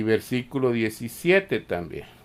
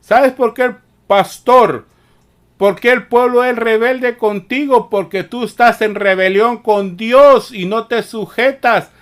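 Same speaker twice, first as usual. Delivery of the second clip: louder and higher, building to a rebuke; fiery preaching; 6 semitones higher, 6 dB louder than usual. Real, not acted. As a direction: preach it hard at the rebellious pastor. Preacher rebukes pastors at full force.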